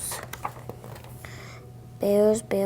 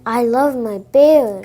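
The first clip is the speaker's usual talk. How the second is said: louder, excited read-aloud; 3 semitones higher, 8 dB louder than usual. Shown as a demonstration. Child rereading at teacher's request, excited. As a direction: say it like the bears thrill you. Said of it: "Can you say that like you're excited?".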